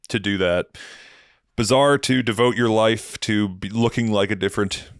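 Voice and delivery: ordinary delivery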